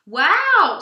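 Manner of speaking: a big wowed gasp of a word; an impressed friend at the park; amazed. Acted. Moose amazed by the camera.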